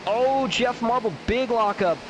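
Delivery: startled race commentator's call; excited reaction. Real, not acted. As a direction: react to a sudden mishap on track. Reacts live to sudden lockup.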